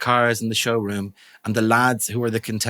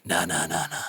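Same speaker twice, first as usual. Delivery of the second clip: cocky brush-off; 4 semitones lower than usual. Retold or imitated. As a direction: wave off the installer in a whisper. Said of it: "And you're like".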